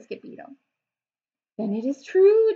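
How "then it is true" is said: higher, louder solemn pronouncement; grand louder decree. Acted. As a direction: proclaim it to the whole pack. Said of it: "decreed Don Diego".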